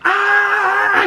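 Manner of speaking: very high short scream, hammed up; an angry yell as she hits the car; imitated rage scream. Harcourt beating her dashboard.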